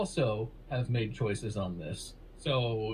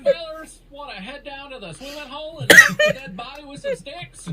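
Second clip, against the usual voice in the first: high, loud hammed-up yokel; mock country voice; 12 semitones higher, 12 dB louder than usual. Mocking Mark's off-camera voice, loud hammed-up yokel. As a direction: holler it like a backwoods kid. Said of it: "that's how Mark actually talks".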